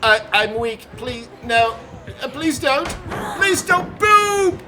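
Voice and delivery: high, loud whiny begging; mock pleading. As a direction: beg the killer in a hammed-up way.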